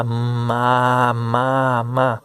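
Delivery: ma said short and quick, over and over; teacher showing the sped-up blend; quick blended syllable. Shown as a demonstration. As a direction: run the sounds together fast. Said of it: "falar elas rapidinho".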